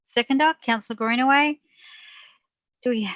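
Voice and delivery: ordinary talk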